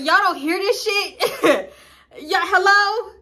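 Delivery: slow, high, laughing through it; can't believe it, laughing out the words; laughing disbelief. Retold or imitated.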